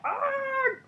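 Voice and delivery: very high held mock scream. Scientists falling, high mock scream. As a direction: squeal it high. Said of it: "scientists are all".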